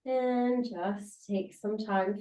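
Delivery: ordinary delivery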